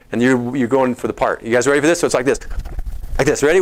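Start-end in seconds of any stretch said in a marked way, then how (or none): none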